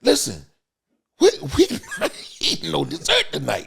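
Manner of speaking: high, loud telling-off with a laugh; playful scold, pitched high; loud mock scolding. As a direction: bark the rule at him, half laughing. Scolding friend about dessert.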